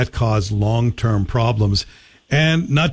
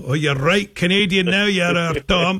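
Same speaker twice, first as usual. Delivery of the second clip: lightly put-on Scottish accent, pitched higher; imitated Scottish accent; 6 semitones higher than usual. Relatives in Scotland joking with the returning emigrant, in an imitated Scottish accent. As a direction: tease in a mock Scottish accent, voice lifted. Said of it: "go back and visit Scotland".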